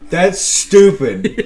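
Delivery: hammed-up sulky objection; imitating defiant friend. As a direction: drag it out in indignant protest. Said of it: "he was defiant in the back".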